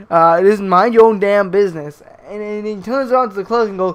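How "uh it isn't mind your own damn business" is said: louder, hammed-up indignant comeback; imitated irate customer; mock angry retort. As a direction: fire it back in mock outrage. Acted retort in quarrel.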